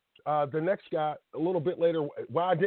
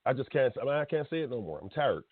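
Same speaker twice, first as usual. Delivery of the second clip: ordinary talk, nothing marked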